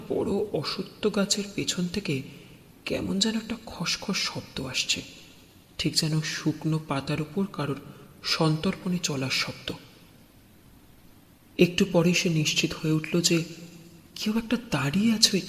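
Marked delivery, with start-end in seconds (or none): none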